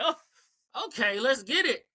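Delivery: high, eager reaction; excited. Retold or imitated. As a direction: pitch up like you just saw the trailer. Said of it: "I was like".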